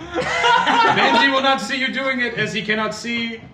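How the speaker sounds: high, slow, grand captain voice; pompous captain. Acted. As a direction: lord it over the crew. Captain addresses crew in character.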